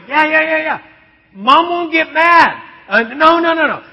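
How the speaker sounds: high, loud rapid reassurance; eager dad. Retold or imitated. Dad assuring daughter Mom won't mind, high and loud. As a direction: gush yes to a delighted kid.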